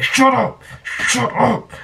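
high urgent shut up; urgent hush. Retold or imitated. Hiding from the owner, urgently hushing the chihuahua, high-pitched. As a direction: snap at the dog to hush. Hissing at barking dog.

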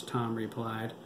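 ordinary delivery

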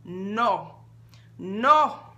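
firm employer's rebuke, rising to loud; boss lady. Acted. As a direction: shut her down like a boss. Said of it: "She sounded like her boss lady".

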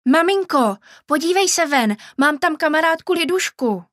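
put-on child calling out. A narrator's reading. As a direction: call out to mum with excitement. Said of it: "hned volal". (vysoké, hlasité dětské volání)

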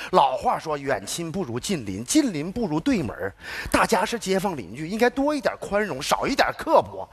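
low, slow sage voice; moralising. Acted. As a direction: intone the proverb gravely. Sententious proverb lecture.

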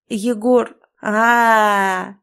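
stretched, slow wail on the name; wailing through tears. A narrator's reading. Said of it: "Усмехнувшись сквозь слёзы".

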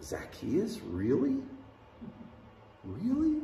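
rising disbelief, last really jumps high; incredulous. Retold or imitated. Walking away from Jesus, incredulous at Zacchaeus. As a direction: scoff in disbelief at the choice.

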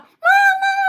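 big booming high yell of a boss. Retold or imitated. Shop owner yelling at workers, booming high shout. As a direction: bellow it like the owner at work. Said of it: "Lumalaki yung boses niya, tapos nag-yell".

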